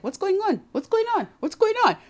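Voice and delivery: high-pitched, repeated clueless asking; puzzled, out-of-the-loop mock voice; bewildered dad.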